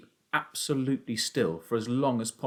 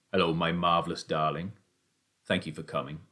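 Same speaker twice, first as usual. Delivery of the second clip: tender father; 5 semitones lower than usual. A narrator's reading. Father thanks son for coming, tender. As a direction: greet tenderly in a lower voice.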